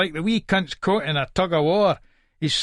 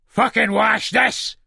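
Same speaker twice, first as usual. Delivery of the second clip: raised, drawn-out furious command; angry order; 6 semitones higher, 3 dB louder, slower than usual. Acted. Husband forcing wife at the sink, angry. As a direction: order it angrily, stretching the words.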